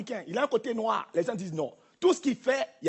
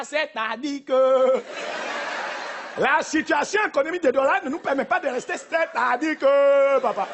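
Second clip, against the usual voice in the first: put-on slow, drawn-out Cameroonian African accent. Comedian mocking how the Cameroonian spoke on arrival, slow Cameroonian accent. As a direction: stretch c'est-à-dire que like a Douala newcomer. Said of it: "tu parlais comment?".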